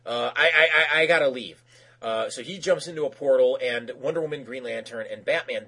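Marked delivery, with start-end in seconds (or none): 0.1-1.6 quick, higher mock panic; mildly flustered, hurried protest; retold freak-out. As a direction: imitate a man caught in the act, stammering to get away. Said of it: "he freaks out".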